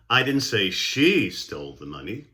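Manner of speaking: single out "she" with strong emphasis; stress on SHE. Shown as a demonstration. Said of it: "stressing of certain words".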